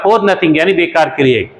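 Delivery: usual speaking voice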